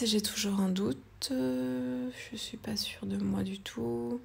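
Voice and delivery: breathy French whisper of self-doubt; whispered aside. Real, not acted. Artist whispering to herself, unsure of the colors, hushed.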